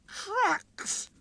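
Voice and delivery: high, disdainful snort. A narrator's reading. Sir Handel scorning the quarry trucks. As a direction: snort the word in disgust. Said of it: "snorted Sir Handel".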